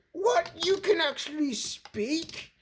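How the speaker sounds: high shocked "What?"; surprised. Acted. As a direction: jump up in disbelief. Hero shocked mini talks.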